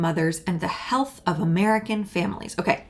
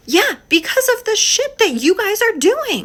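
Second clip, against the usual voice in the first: angry, much higher and louder retort; exasperated outburst; 12 semitones higher, 6 dB louder than usual. Real, not acted. A creator angrily answering a politician's claim on TikTok, much higher and louder. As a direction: throw it back at them in disbelief. Furious rant at the administration.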